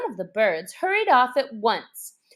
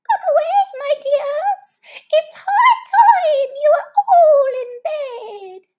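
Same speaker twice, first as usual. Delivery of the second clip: much higher, loud quavering call; trembling; 10 dB louder than usual. Acted. Canary calling its children away, high and trembling. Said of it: "called out in a trembling voice".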